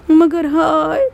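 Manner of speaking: slow breaking cry of grief; drawn-out sob on hāy. Real, not acted. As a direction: let the voice break on the lament. Lament peak, cry heard.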